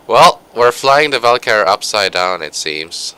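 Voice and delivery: normal talking voice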